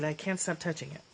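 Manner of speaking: normal talking voice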